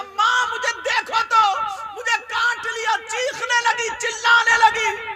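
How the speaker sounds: loud, high, slow preacher's chant; preaching at full force. Acted. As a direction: drive the warning home at full voice. Preaching, girl screaming.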